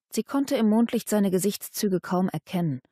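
ordinary delivery

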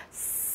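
voiceless s, held like a snake's hiss; s as a long unvoiced hiss. Shown as a demonstration. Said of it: "nghe nó giống như kiểu tiếng rắn".

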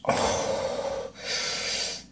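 long unvoiced sigh; heavy sigh. Real, not acted. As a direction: exhale hard in pain. Chili pain after holding breath.

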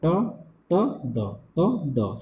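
soft repeated "त, द"; dental demo. Shown as a demonstration. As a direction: drill "त, द" gently. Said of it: "ये दो sound कौन सा होता है?".

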